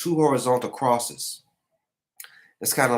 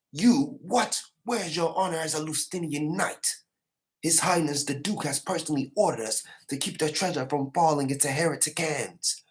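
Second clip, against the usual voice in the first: outraged knight; 7 semitones higher than usual. Retold or imitated. Outraged knight rebukes traitor.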